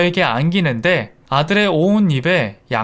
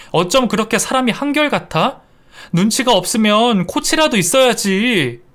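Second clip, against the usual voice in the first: sharp retort; 7 semitones higher than usual. Acted. Wife snaps at tactless husband.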